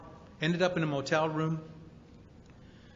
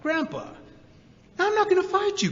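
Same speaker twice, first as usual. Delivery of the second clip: pleading refusal; 5 dB louder than usual. Retold or imitated. Refusing to fight grandpa.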